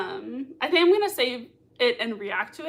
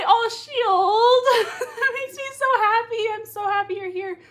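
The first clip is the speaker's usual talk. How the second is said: high, loud squeal of delight; real high-pitched joy, easing in volume; delighted gushing; 10 semitones higher, 6 dB louder than usual. Real, not acted. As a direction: gush over a friend's arrival. Delighted greeting of a viewer.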